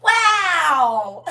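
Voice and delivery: put-on high, loud child's "wow"; amazed grandson. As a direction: gasp it out like a wowed kid. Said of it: "He said".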